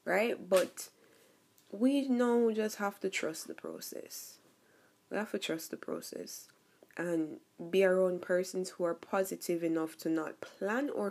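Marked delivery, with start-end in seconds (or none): 5.1-6.5 whispered repeat, voice dropped away. Real, not acted. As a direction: breathe the words, no voice. Repeats line, then whispers it.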